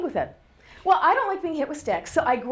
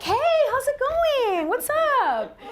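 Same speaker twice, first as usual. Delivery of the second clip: breezy cheerful greeting; 12 semitones higher, slower than usual. Retold or imitated. Cheery greeting ignoring crying son.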